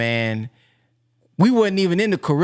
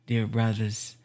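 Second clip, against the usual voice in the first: gentle minister; 7 semitones lower than usual. Retold or imitated. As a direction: coo like a kind minister checking on his flock. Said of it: "And then the minister said".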